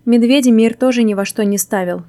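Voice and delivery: ordinary delivery, nothing marked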